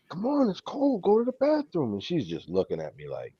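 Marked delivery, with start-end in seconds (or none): 0.1-1.9 much higher coaxing plea; coaxing the dog. Retold or imitated. Owner coaxing the puppy outside in the cold, high pleading. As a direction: sweet-talk the dog way up high.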